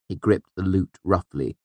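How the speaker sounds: ordinary delivery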